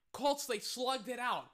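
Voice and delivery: normal talking voice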